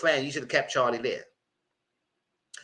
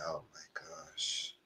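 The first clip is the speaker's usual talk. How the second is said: slow breathless whisper, much softer; 10 semitones lower, 19 dB quieter, much slower than usual. Retold or imitated. Host retelling her stunned reaction, whispered oh my gosh. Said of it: "I said".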